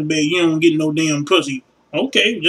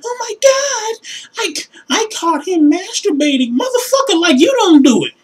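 put-on much higher squealing girly voice; mock outraged girlfriend; 12 semitones higher than usual. Girlfriend complaining she caught him masturbating, in high mock squeal. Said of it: "chicks getting mad".

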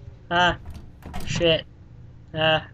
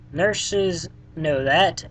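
reading the hint slowly; much slower than usual. Shown as a demonstration. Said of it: "reading out the hint clues".

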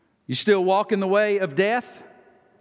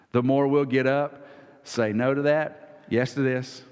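usual speaking voice

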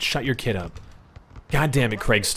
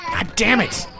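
frustrated yelp; 11 semitones higher, slower than usual. Real, not acted. Frustrated outburst.

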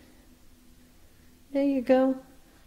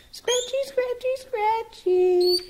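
very high sing-song coo to the bird; slower than usual. Real, not acted. Coos baby talk to pet bird.

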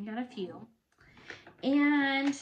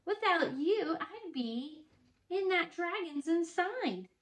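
grateful witch voice; 7 semitones higher, slower than usual. Acted. Witch thanks her friends.

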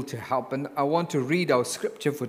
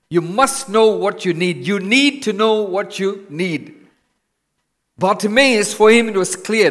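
louder, higher preaching push; fervent preaching; 3 semitones higher, 7 dB louder than usual. Real, not acted. Preacher urging congregation to know their need, fervent. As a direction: drive the point home to the church.

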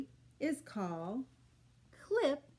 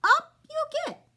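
much higher, louder cheery horse; cheerful horse; 8 dB louder than usual. Acted. Horse welcomes the animals aboard, high and loud. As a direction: call it out like a jolly horse. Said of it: "says Mister Horse".

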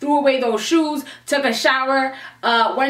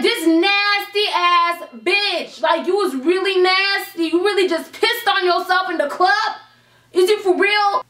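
put-on raised, outraged disbelief at a drunk girl; disgusted telling-off; 6 semitones higher than usual. Drunk narrator scolding the girl who peed, outraged. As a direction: scold her, higher and incredulous.

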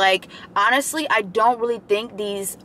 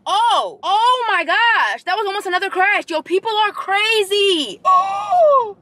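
real shock; 10 semitones higher, slower than usual. Real, not acted. Watching another near crash outside, real shocked cries. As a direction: cry out in disbelief at the road.

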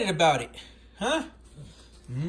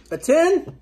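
excited; 8 semitones higher, 5 dB louder than usual. Real, not acted. Delighted at baby's rating.

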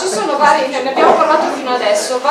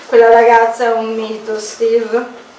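ordinary delivery

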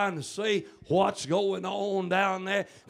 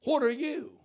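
higher-pitched, puzzled question; questioning pastor; 10 semitones higher than usual. Acted. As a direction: put the woman on the spot. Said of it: "And the pastor said".

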